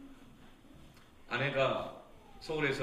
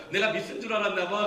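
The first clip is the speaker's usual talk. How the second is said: cocky man's remark; 6 semitones higher, 6 dB louder than usual. Retold or imitated. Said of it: "막 웃더니 이렇게 말하는 거예요".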